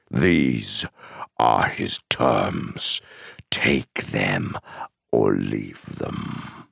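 very low, slow, menacing envoy; cold, weighty dark herald; imperious Mouth of Sauron. Acted. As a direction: deliver the ultimatum low and drawn out. Messenger delivers ultimatum.

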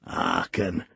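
put-on slow Yorkshire old man muttering. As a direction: grumble it like an old Yorkshire servant. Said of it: "muttered Joseph".

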